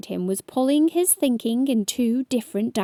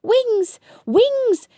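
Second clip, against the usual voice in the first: high, louder urgent call; shouted; 13 semitones higher, 5 dB louder than usual. A narrator's reading. Ladybird trying to fly away, high shout. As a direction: call on your wings, high and loud. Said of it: "she shouted".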